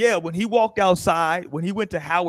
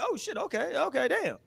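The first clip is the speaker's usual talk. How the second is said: higher, softer impressed gasp; mock surprised woman; 7 semitones higher, 7 dB quieter than usual. Woman realizing he's Puerto Rican, quietly impressed. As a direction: act the girl catching on. Women reacting, retold.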